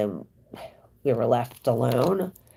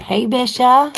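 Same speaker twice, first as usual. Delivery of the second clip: loud greeting; 12 semitones higher, 10 dB louder than usual. Real, not acted. Greeting viewer Bisha.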